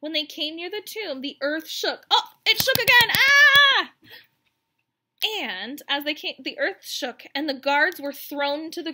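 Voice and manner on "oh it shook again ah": sudden high frightened yelp as the ground shakes; startled cry. Acted. Women at the tomb, startled by the quake: high frightened cry. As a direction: act the fear of the quake, loud and high. Earthquake scene acted out.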